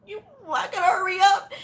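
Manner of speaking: frantic, hammed-up inner voice; mock panic. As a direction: play up the rush of panic. Panicked thought retold from exam.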